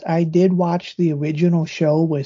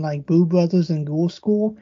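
ordinary talk, nothing marked

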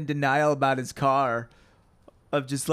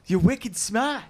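put-on Boston accent; 5 semitones higher, slower than usual. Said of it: "but with a Boston accent".

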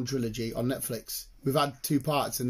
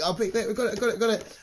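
excited; 6 semitones higher, faster than usual. Retold or imitated. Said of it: "I was like".

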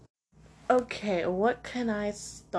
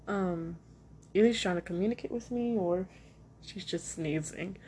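ordinary talk

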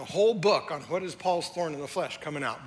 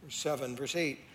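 ordinary talk, nothing marked

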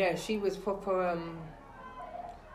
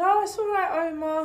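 mock sweet; 10 semitones higher, 4 dB louder than usual. Teasing him while recounting the meeting.

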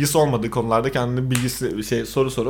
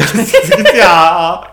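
laughing outburst; 4 semitones higher, 10 dB louder, slower than usual. Real, not acted. Mock outrage while laughing.

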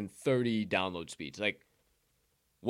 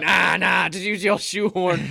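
loud, higher dismissive grandpa; mock insisting; 6 semitones higher, 9 dB louder, slower than usual. Grandfather pushing the old shoehorn on grandson, loud mock insistence. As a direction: wave off the complaint loudly.

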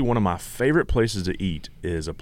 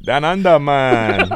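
loud, higher, hammed-up Jamaican accent; mock Jamaican accent; 5 semitones higher, 7 dB louder, much slower than usual. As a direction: play up the Jamaican accent for laughs. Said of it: "It sounded more Jamaican than".

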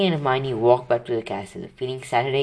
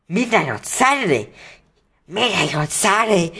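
hoarse, raised alarmed voice; 7 semitones higher than usual. Acted. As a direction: croak it out in alarm. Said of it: "he said in a hoarse voice".

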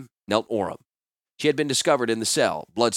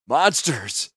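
bitter, raised cry; angry exclamation; 5 semitones higher, 4 dB louder than usual. A narrator's reading. Maimed captive raging at his captors, angry exclamation. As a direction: spit it out in outrage.

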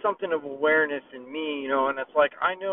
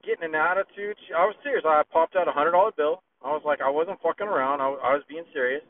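ordinary talk

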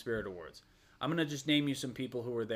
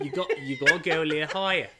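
louder demo of an Australian accent; exaggerated Aussie accent, amused; 3 semitones higher, 6 dB louder than usual. Shown as a demonstration. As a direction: push the Australian accent harder. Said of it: "here's how you".

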